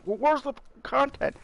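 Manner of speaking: high mocking complaint; whiny viewer voice. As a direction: mimic a nagging fan.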